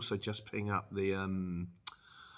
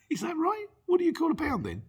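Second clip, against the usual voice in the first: high incredulous questions; can't quite believe it; amused disbelief; 4 dB louder than usual. Real, not acted. Asking Birmingham viewers, amused.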